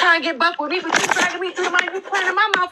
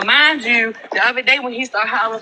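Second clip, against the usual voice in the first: normal talking voice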